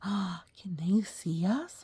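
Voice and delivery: gasp, then a slow whispered question; hushed play fright for a child; mock scared whisper. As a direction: pretend to be spooked by the picture. Gasp at cats looking out.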